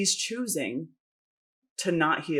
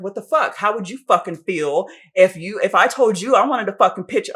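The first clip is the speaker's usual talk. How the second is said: louder, rising fury, swearing hard; retold angry rant; 4 semitones higher, 9 dB louder than usual. Telling her friend how she yelled at her ex: mock angry rant. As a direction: replay the blow-up in the car. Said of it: "I just went the fuck off on this guy".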